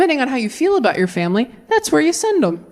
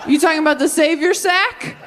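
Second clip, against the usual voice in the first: higher, quick, sneering church men; mock dismissive men; 7 semitones higher than usual. Churchmen mocking Mary, sneering and laughing. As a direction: rattle off crude names with glee. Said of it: "all the men were like".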